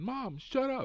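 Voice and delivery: high-pitched imitation of a child; imitated embarrassed kid.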